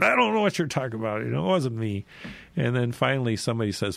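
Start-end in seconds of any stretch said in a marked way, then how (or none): 0.0-2.0 fast, higher-pitched retold protest; hammed-up, insistent denial rushed out; stronger denial. Peter answers his second accuser, stronger mock protest. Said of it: "Peter even gets stronger every time".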